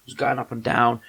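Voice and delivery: ordinary talk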